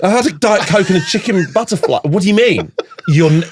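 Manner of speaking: high, laughing protest; laughing outrage. Pretend. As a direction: splutter indignantly through laughter. Outraged diner ejected, laughing.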